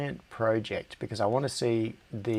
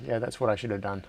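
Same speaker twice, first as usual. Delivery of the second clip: normal talking voice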